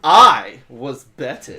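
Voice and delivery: loud, slow, puffed-up pride; proud voice. Acted. Player showing how the scarecrow should say it, loud and proud. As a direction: declare it proudly, drawing it out. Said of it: "In a proud voice".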